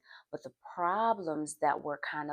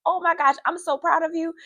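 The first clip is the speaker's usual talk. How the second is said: loud, high-pitched imitation of a proud teacher; gushing praise; 13 semitones higher, 9 dB louder than usual. Teacher praising her pupil's focus, gushing.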